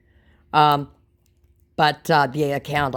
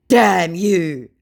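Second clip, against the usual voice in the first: loud, higher angry curse; scammer's mock curse; 5 semitones higher, 9 dB louder than usual. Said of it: "said the scammer, realizing he'd fucked up".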